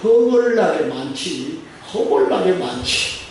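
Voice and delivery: slow Jeolla accent, words stretched out; Jeolla dialect. Shown as a demonstration. Preacher demonstrating thanks to the congregation in Jeolla dialect. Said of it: "전라도 버전으로".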